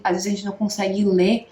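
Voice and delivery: ordinary delivery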